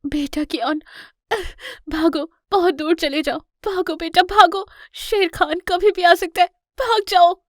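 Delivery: high, building to a loud alarmed cry; urgent mother. Acted. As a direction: urge your child to run, rising in panic. Wounded mother urges cub to flee. (घबराई माँ की बढ़ती हुई, ऊँची चेतावनी)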